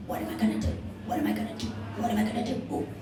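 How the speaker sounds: whispered, breathless worry of a crushed bird; squashed whisper. Acted. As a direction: barely get the words out under the weight. Turkey being squished under mayor.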